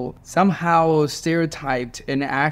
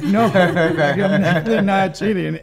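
laughing, higher and louder; laughing denial; 6 semitones higher, 5 dB louder than usual. Retold or imitated. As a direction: laugh it off while denying. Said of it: "And I'm like".

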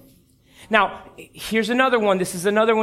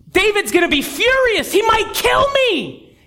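frightened messenger; 8 semitones higher than usual. Acted. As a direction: beg not to be the one to tell him. Messenger fears the king's anger.

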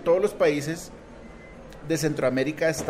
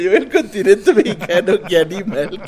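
loud, slow, through laughter; words breaking into a high, loud laugh; laughing at oneself; 6 semitones higher, 11 dB louder than usual. Real, not acted. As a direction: crack up over your own blunder, voice raised. Laughing at own slip.

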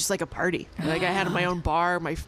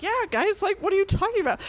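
imitating clueless girlfriend; 11 semitones higher, faster than usual. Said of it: "And she's like".

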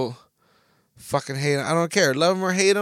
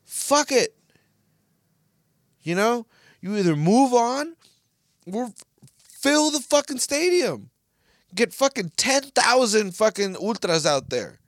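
heated rant; 9 semitones higher than usual. Real, not acted. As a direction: let the frustration boil over. Rant continues.

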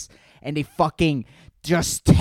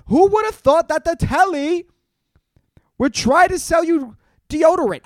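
put-on British accent, much higher and louder; vague British accent; 13 semitones higher, 6 dB louder than usual. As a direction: ham up a mystery British accent, pitched way up. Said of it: "I don't even know what accent that is".

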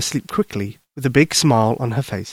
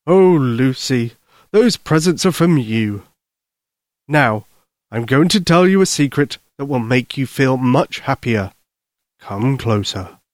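kindly Santa Claus. Acted. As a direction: soothe a worried child as Santa, unhurried.

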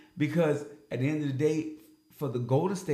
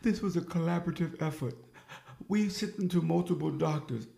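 a light higher mock of the GM's careful points; 5 semitones higher than usual. Host parodies the GM's press statement, lightly higher. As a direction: parrot the GM's talking points, lightly mocking. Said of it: "Point by point on everything you're saying".

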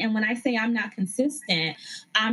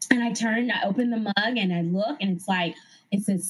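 ordinary delivery

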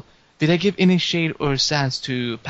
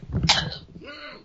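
rough throat-clearing cough; 5 dB quieter than usual. Real, not acted. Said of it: "I'm really losing".

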